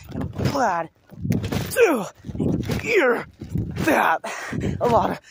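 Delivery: words jolted up high mid-bounce; on a trampoline. Real, not acted. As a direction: speak between big jumps. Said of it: "Right now, I'm bouncing".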